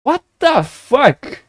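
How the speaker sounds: high, outraged disbelief, slowing at the end; can't believe he's called a cheater; indignant disbelief. Retold or imitated. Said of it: "And then I was like".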